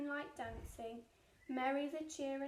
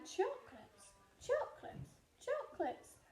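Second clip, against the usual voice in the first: echo; 8 semitones higher than usual. Acted. Echo from the cave.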